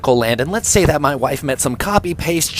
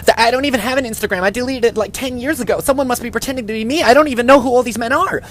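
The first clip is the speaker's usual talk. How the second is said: higher, fast flustered denial; panicking wife; 7 semitones higher than usual. Retold or imitated. Wife caught at the altar, higher panicked voice. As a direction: babble a frantic excuse. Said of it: "She starts panicking".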